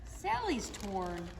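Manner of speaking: usual speaking voice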